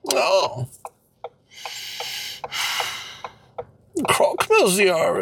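fake French. Mock French couple role-play.

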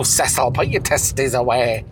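ordinary talk

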